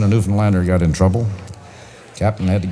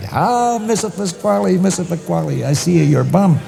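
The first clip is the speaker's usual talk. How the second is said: Italian Luigi; 11 semitones higher than usual. Acted. Luigi calls to Mister Pasquale round the corner, high Italian accent. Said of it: "Luigi is coming around the corner".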